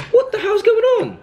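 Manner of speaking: high startled cry of disbelief; jolted by the creepy scene, voice leaps up; spooked. Real, not acted. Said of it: "I actually got goosebumps".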